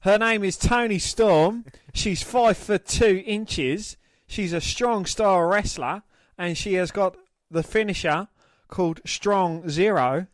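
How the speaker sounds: lightly put-on Australian accent, slow; a hint of Australian, drawn out slowly. Mate asked to redo the bio, in a slow mock Australian accent. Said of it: "Now do it in an Australian accent".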